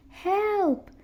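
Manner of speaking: high cry for help; frightened high shout; yelled. A narrator's reading. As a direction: call up from the hole. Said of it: "she yelled".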